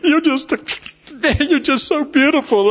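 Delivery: high voice breaking up mid-phrase; tearful, high-pitched gush; retold crying. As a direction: re-enact a weeping dad struggling to get the words out. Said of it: "and I'm just crying".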